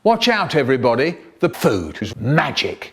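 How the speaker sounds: usual speaking voice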